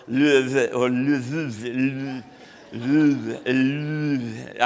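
hammed-up French pronunciation of Louvre; jokey French accent, drawn-out Louvre. Shown as a demonstration. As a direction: say Louvre like a cartoon Frenchman, trying it over and over. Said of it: "I try to do an accent".

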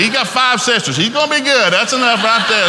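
loud, high, laughing objection; laughing protest. Retold or imitated. Dad refusing a sixth child, loud and high with laughter. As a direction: laugh it off loudly.

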